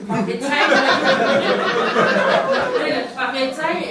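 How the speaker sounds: laughing. Retold or imitated. Comic scene, laughing.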